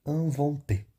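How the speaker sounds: "inventé" with IN as nasal "an"; French pronunciation demo. Shown as a demonstration. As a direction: say "inventé" ending on the T syllable, "an-von-té". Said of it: "só até o T".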